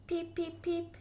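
high squeaky little duckling peeps; baby duck peeping. Acted. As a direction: chirp it out high like a tiny duck. Baby duck's peeps in story.

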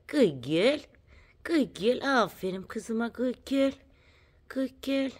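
high cooing pet talk; cooing praise. Real, not acted. Telling the girl she did well, high cooing praise.

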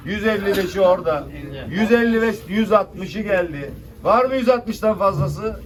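loud auction call; auctioneer. Real, not acted. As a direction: call the lot to the room. Calling rising bids.

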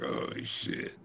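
hushed, sinking whisper; dismayed whisper. Retold or imitated. Drummer, realizing [name] is eyeing his girlfriend, whispers in dismay. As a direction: mutter it under your breath in dismay. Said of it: "And I'm like".

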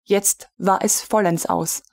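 plain reading voice

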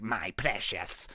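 low, slow, quiet creature voice; Smeagol. Retold or imitated. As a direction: imitate the creature, drawn out and soft. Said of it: "He normally goes like".

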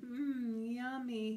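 dreamy, savoring mumble; mumbling. A narrator's reading. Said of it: "he mumbled".

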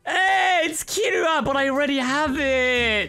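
high, loud outraged complaint; exasperated shout. Real, not acted. Gamer getting a duplicate character, shouting fed up.

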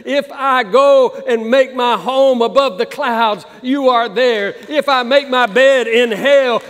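loud, fervent pulpit delivery building up; full-force preaching. Acted. Pastor calls the lost back home, loud and fervent. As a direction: preach it out with fire.

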